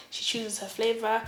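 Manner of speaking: plain reading voice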